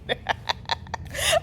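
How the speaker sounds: airy high-pitched laugh bursting out; breathless high laugh. Real, not acted. Vlogger cracking up about Carol, high laugh.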